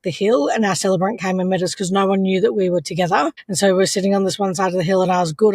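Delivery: ordinary talk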